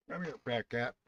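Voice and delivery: ordinary talk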